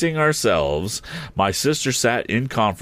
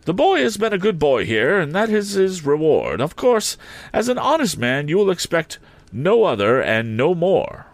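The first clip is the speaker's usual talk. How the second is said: put-on higher, firm old lady, every word distinct; distinct, emphatic; 9 semitones higher than usual. A narrator's reading. Miss Havisham instructing Joe, distinct and emphatic. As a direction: lay it down firmly to Joe. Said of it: "in a distinct, emphatic voice".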